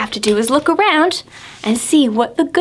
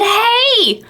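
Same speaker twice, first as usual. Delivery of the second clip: much higher cheerful hey; Pete brightening; 10 semitones higher than usual. Acted. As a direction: perk up in Pete's high voice. Pete calls out.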